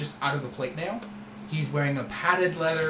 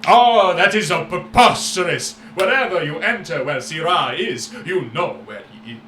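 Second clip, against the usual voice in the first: louder, sweeping showman voice; grand merchant boast; 3 semitones higher, 7 dB louder than usual. Acted. Merchant Syrah boasting to the young friend, loud and showy. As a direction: boast like a flamboyant trader.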